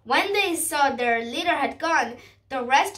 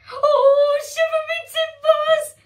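very high, loud wail; panicked wail; 12 semitones higher, 7 dB louder than usual. A narrator's reading. Cowardly captain finding stolen treasure aboard, wailing in panic. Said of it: "he wailed".

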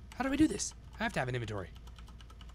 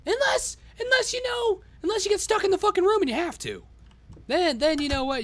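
1 mock high voice; 5 dB louder than usual. Mocking his own idea.

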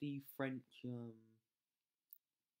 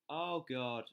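normal talking voice

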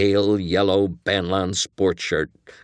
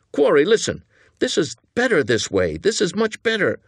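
higher, pleading insistence; urgent persuasion; 10 semitones higher than usual. Acted. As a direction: plead with your partner to hear you out. Accomplice pleading to save the plan.

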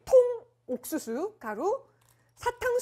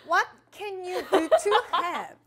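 laughing English read; 5 semitones higher, 8 dB louder, much slower than usual. Shown as a demonstration. Reading English card, laughing.

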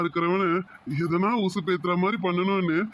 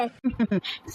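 laughing; 6 semitones higher than usual. Acted. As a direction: chuckle at being caught sweet-talking. He laughs at mother's teasing.

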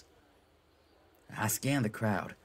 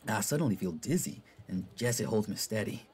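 ordinary delivery

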